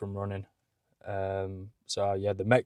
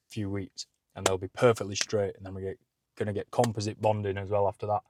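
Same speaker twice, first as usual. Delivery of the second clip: usual speaking voice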